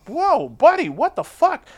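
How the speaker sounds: surprised scolding of a friend; startled high whoa. Retold or imitated. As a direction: caught off guard, high and loud. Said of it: "and I'm like".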